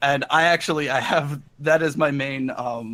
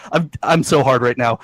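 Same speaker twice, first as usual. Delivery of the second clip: normal talking voice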